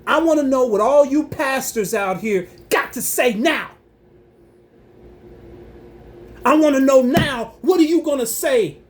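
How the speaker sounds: real heated challenge to silent pastors. Real, not acted. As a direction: call them out across the room. Challenging pastors, calling them out.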